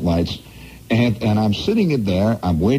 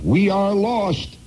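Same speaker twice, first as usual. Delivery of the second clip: higher, slow, hammed-up cry of despair; mock captain's cry; 11 semitones higher, slower than usual. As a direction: stretch it out like a doomed captain. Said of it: "the captain shouted".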